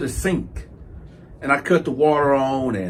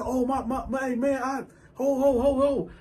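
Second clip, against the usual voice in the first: high, softer mock panic; flustered stammer; 7 semitones higher, 7 dB quieter than usual. Caught bunkie begging for a moment, flustered. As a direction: stumble over the words, pitched high.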